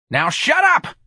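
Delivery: high-pitched exasperated yell; sudden shrieked order, voice leaping high; angry scream. Acted. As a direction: cut them off with a scream. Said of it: "Mister Clyde screamed".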